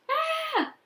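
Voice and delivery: sudden loud high scream of fright; loud frightened scream. A narrator's reading. Kent screams when something touches him in the attic, frightened. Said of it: "Then he screamed".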